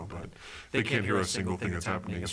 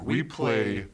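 slow, drawn-out emphasis; very slow, showy promo delivery; dramatic hype; 3 semitones higher, much slower than usual. Acted. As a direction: stretch each key word for effect. Hyping up the boys' sleepover.

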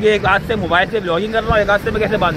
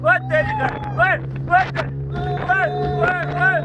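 excited yelling; 8 semitones higher, 4 dB quieter than usual. Real, not acted. Thrilled cries on the jet ski.

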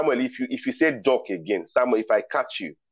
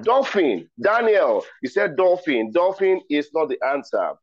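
playful mock outrage; 6 semitones higher than usual. Quiz host to Daniel on his wrong answer, mock outraged and high. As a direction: tease the player for a silly answer.

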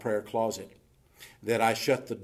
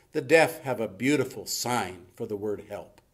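ordinary talk

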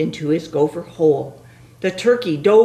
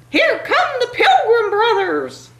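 panicky turkey voice; 3 dB louder than usual. Acted.

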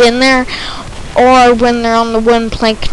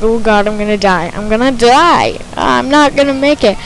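ordinary talk, nothing marked

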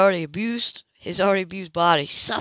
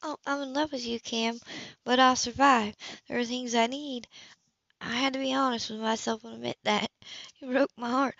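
soft and hurt; 4 semitones higher, 6 dB quieter than usual. Acted. Woman confessing, hurt.

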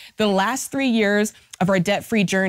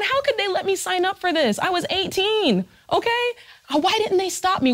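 higher, whiny mock complaint; indignant young borrower; 8 semitones higher than usual. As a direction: play the eighteen-year-old who feels cheated. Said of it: "we're going".

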